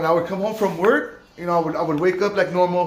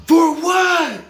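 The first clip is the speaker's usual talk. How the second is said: gasp, then a high, loud outburst; shocked protest; 11 semitones higher, 6 dB louder than usual. Retold or imitated. Resident told he is on exile, shocked gasp and protest. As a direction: act out outraged disbelief.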